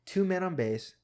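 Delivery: usual speaking voice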